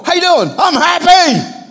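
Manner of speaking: preacher shows the church how to greet people, with a joyful shout. Pretend. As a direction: burst out happy at a passerby. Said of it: "You're walking to people and go".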